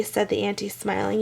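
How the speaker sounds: usual speaking voice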